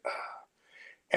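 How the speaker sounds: imitated voiceless sigh; exasperated exhale. Opponent reacting when he didn't kick out, exasperated exhale. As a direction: let out a big breath, mimicking his exhale. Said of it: "like, exhale when I didn't kick out".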